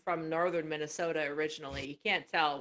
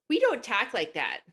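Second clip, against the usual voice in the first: higher, slow Minnesota voice; mock a Minnesotan denying it; Minnesota accent; 6 semitones higher, 4 dB louder than usual. Minnesotans protesting the movie, Minnesota accent. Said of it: "people from Minnesota, like".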